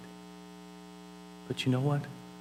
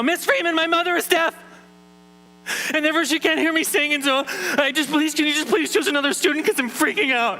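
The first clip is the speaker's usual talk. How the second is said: high, loud, rushed panic; freaking-out boy begging the teacher; panicked kid; 13 semitones higher, 3 dB louder than usual. Retold or imitated. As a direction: blurt it out in a frantic plea. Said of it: "I sit up and I go".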